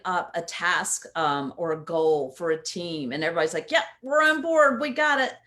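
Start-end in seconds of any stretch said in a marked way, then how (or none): 3.7-5.3 bright, louder eager yes; eager agreement. Retold or imitated. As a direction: sound keen to get going. Eager team agreeing to task.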